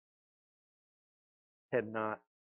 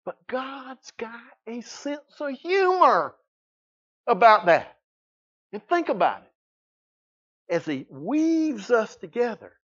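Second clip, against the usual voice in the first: high, loud pulpit delivery; raised preacher's voice, big and emphatic; exuberant preaching; 11 semitones higher, 11 dB louder, slower than usual. Real, not acted. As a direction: belt it out with drawn-out emphasis. Preacher at full force.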